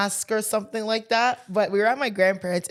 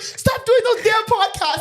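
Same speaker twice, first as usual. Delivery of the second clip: mock scolding, high and slow; a hint of an angry telling-off; imitated pissed-off aunt; 10 semitones higher, 3 dB louder, slower than usual. Aunt scolding the prankster on the phone, mock pissed. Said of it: "She was so pissed on the phone".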